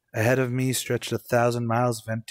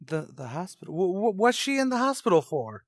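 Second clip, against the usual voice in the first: shocked stammer; 7 semitones higher than usual. Acted. Shocked mother hospitalised.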